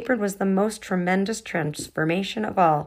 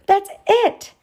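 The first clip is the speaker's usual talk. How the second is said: very high loud shout; 14 semitones higher, 8 dB louder than usual. Acted. Kendall shouting her sudden idea to the girls, loud. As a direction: burst out with the idea. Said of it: "Kendall shouted".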